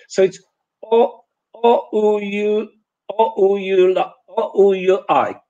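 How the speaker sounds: only the vowels "O, U, U, L, O". Shown as a demonstration. Teacher showing vowels alone are incomprehensible. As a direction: say just the vowel sounds, no consonants. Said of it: "I will keep the vowels".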